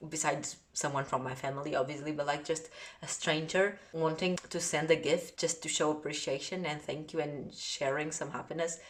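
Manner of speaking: ordinary talk, nothing marked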